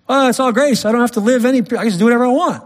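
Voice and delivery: high, breezy mock voice with a fast tail; mocking careless believer. Preacher mimicking a careless churchgoer in a high, breezy mock voice. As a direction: lampoon the excuse-maker, rushing the end.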